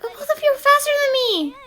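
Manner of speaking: put-on high, plaintive kid voice; high-pitched childish complaint; whining child. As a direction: protest like a helpless little child. Child protests to bullies.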